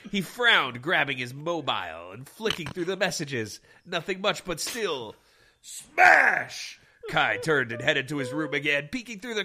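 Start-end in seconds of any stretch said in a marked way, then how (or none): none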